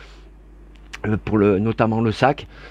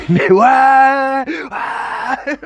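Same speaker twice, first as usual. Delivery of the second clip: loud high laughing yes; 10 semitones higher, 5 dB louder, much slower than usual. Real, not acted. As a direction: crack up on the words. Laughs at his own bias.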